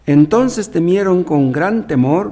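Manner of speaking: slow and loud, "temieron con gran temor" weighted word by word; emphasis on temor. Shown as a demonstration. Preacher stressing the disciples' great fear, slow and loud. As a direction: lean hard on "temor", drawn out. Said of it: "aquí está enfatizada la palabra temor".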